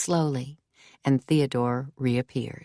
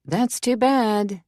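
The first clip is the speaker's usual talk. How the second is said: drawn-out, raised-pitch call; higher, stretched-out call down the hall; calling after; 7 semitones higher, slower than usual. A narrator's reading. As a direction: call after someone leaving. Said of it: "Mimi calls after him".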